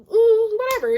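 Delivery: high, loud, brushed-off "mm, whatever"; dismissive whatever. Retold or imitated. To the lying guy on the phone, a high, loud, dismissive brush-off. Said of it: "And I'm like".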